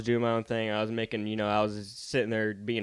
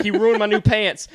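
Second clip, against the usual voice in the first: retold indignant complaint; 10 semitones higher, 10 dB louder than usual. Kid complaining to principal.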